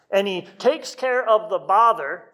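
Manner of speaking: normal talking voice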